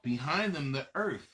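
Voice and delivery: plain reading voice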